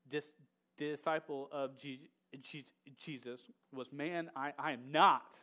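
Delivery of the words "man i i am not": NOT punched much louder; emphatic. Retold or imitated. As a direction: slam the denial down on not.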